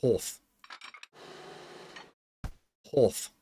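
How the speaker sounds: "Horth" said softly with a rounded vowel; quiet practice of "Horth"; South African half. Shown as a demonstration. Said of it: "the easiest way to get into a South African accent".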